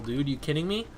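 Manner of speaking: normal talking voice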